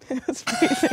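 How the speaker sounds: laughing through the words; giggling it out. Real, not acted. Laughing at the cold together.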